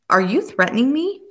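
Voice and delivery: a rising, astonished question. A narrator's reading. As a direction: ask it in disbelief. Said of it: "Rachel asked in astonishment".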